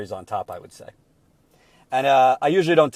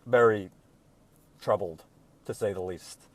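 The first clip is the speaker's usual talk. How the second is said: subdued and low, trailing softer; troubled; 4 semitones lower, 4 dB quieter than usual. Real, not acted. Commentator admitting he's upset by the leaked speeches, troubled. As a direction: let the troubled feeling weigh your voice down. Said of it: "if I'm talking slow or it looks like I'm upset".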